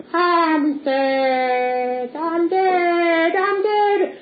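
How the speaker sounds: high, sustained sing-song moan; hammed-up wail. Punch tells the doctor he's dead, high mock wailing.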